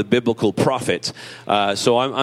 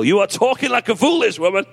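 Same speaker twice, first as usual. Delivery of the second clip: cheeky, relished rebuke, pitched higher; with gusto; 8 semitones higher than usual. Acted. Pastor teasing husbands, quoting Job with gusto. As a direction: lean into it with playful gusto. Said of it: "safe to say when I'm quoting".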